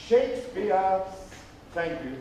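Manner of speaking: ordinary talk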